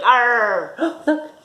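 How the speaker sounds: usual speaking voice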